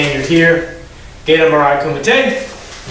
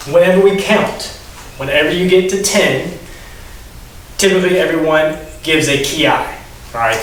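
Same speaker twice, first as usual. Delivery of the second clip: normal talking voice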